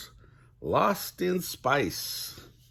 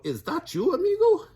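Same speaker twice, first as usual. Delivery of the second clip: high, rising excited question; exclaiming; 6 dB louder than usual. A narrator's reading. Space dog recognizing his friend, exclaiming excitedly. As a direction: shoot way up in pitch on the last word. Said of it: "exclaimed Skipito".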